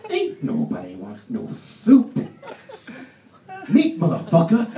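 loud slow gangster growl; tough knife. Acted. Knife scoffing at the ladle, loud slow tough talk. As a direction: shut the ladle down, hard. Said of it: "giving it the big one".

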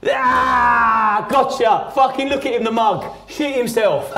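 loud, high gloating jeer; imitating a bully crowing over a prank; dad gloating loudly at his terrified son; mocking taunt. Dad mocking the scared boy.

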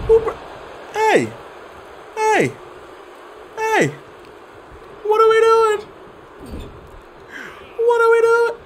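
very high, strained protesting shout; high outraged yelling. Real, not acted. Streamer protesting the game, high-pitched outraged yelling. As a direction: yell up high at the screen in disbelief. Opponent interrupts streamer's match.

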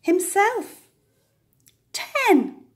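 gleeful final count; triumphant. A narrator's reading. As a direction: land the last number with glee.

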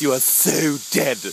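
normal talking voice

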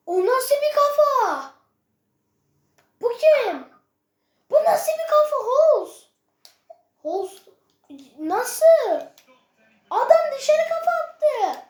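furious yelling. Real, not acted. Raging at teammate Hols' header.

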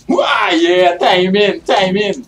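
excited shouting. Real, not acted. Excited gaming outburst.

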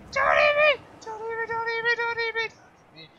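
high squealed pleading, hammed-up terror; mock panic. Gamer begging a sea monster not to eat him, squeaky mock panic. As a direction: beg the monster in a squeaky shriek.

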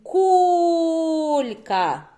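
КУЛЬКА with a long, high, loud КУУЛЬ; calling the word out. Shown as a demonstration. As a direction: holler КУЛЬКА, holding the first syllable. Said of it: "А ну, давай ще раз". (голосно й протяжно кличе: «КУУЛЬка»)

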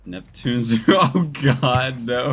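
loud laughing outburst; excited disbelief. Real, not acted. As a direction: shout it in amused disbelief.

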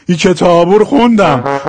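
Shirazi ending, u in کتابو; Shirazi accent. Shown as a demonstration. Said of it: "حال شیرازیش". (کتابو با لهجهٔ شیرازی)

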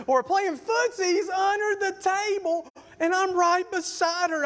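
high shouted disbelief; mock outrage. Boyfriend betrayed under the table, high mock outrage. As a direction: cry it out, hammed-up and high.

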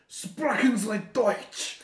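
exaggerated German accent. Shown as a demonstration. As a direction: belt it out in a mock German accent. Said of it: "I wanna get a good Russian accent".

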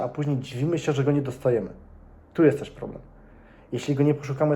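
usual speaking voice

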